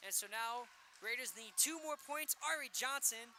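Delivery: usual speaking voice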